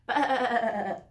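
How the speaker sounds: little lamb calling Bah; lamb bleat. Acted. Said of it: "said the little lamb".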